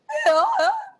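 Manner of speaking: high, loud braying hee haw; hammed-up donkey hee-haw. Shown as a demonstration. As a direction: bray like a donkey with a squeal. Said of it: "donkey voice".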